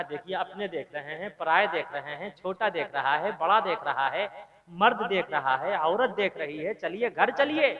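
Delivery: soft, low coaxing plea; wife quietly begging her drunk husband to come home; pleading softly. Acted. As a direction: hush him home gently.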